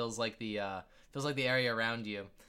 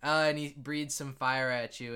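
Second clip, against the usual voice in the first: usual speaking voice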